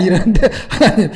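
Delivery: ordinary delivery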